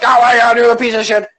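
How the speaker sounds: hammed-up indignant outburst; mock yelling. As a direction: play someone blowing up over nothing. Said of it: "'cause you're yelling at me".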